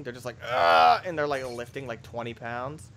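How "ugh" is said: loud straining grunt; imitated gym grunt. Imitating people lifting at the gym, strained grunt. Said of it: "they're grunting".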